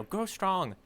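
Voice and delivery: usual speaking voice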